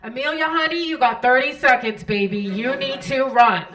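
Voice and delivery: loud, bossy intercom holler; brash New York announcer. Acted. New York lady hurries a late passenger over intercom, brash shouting. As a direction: holler it like a pushy New York gate agent. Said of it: "this lady from New York gets on the intercom".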